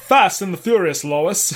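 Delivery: lightly voiced cartoon husband; a hint of a cartoon dad impression; Peter Griffin. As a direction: toss it off as a cartoon character. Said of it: "That's Peter Griffin".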